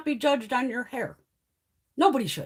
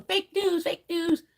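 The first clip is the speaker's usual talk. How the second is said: mock a ranting heckler in a higher voice; mock yelling; 6 semitones higher, slower than usual. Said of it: "some orange fucking moron starts yelling".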